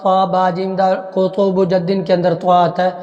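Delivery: normal talking voice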